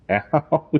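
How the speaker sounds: high laughing ouch; laughing yelp. Real, not acted. Cat bites again.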